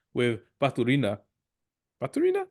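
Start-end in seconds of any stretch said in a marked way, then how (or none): 2.0-2.5 put-on Spanish accent. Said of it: "I said it in a Spanish accent".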